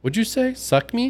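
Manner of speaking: high, indignant mock squawk; pissed-off caller. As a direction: play the offended saleswoman rising in disbelief. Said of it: "she gets kinda pissed".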